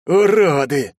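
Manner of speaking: high, loud angry insult; злобно процедить. A narrator's reading. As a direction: spit the insult out. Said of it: "процедил". (со злостью выругаться)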